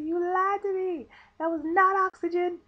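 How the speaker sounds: hammed-up indignant yell, stretching the words; indignant accusation. Patient accusing anaesthesiologist over the oxygen, indignant accusation.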